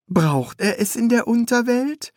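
high, eager apprentice voice; high-pitched apprentice calling his master; young apprentice. A narrator's reading.

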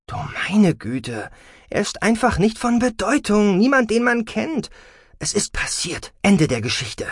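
impatient mother's emphatic dismissal; exasperated. A narrator's reading.

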